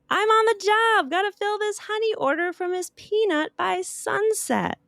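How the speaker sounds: a busy bee waving her off; buzzing bee voice. Acted. Hank the honeybee reassuring Opal, high and buzzy.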